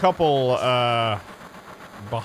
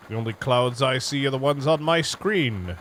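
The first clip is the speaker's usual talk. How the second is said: usual speaking voice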